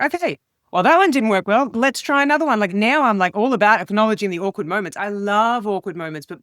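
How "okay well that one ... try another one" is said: breezy call-out. Shown as a demonstration. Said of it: "I call it out".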